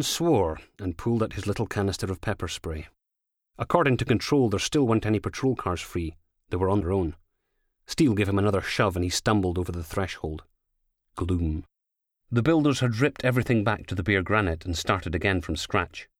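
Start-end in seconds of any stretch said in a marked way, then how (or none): none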